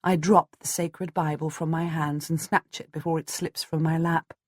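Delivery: ordinary delivery, nothing marked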